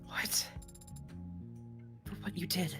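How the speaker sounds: normal talking voice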